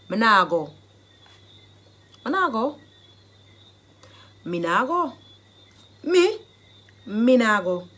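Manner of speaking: loud defiant Jamaican patois refusal. Shown as a demonstration. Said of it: "the Jamaican dialect phrase".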